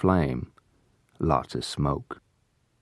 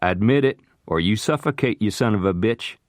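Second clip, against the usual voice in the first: louder, higher angry demand; furious shout; 5 semitones higher, 3 dB louder than usual. Acted. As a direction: shout the threat at him.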